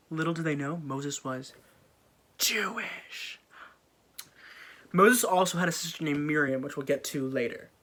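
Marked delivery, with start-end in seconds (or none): 0.8-3.4 hushed, breathy reveal; whispered secret. Acted. Telling the audience Moses' secret, whispered.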